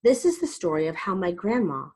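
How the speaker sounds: plain reading voice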